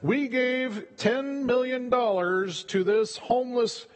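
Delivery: slow, smug boast; slow, preening corporate brag; mock bragging. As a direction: slowly puff up like a self-satisfied donor. Mocking a bragging company.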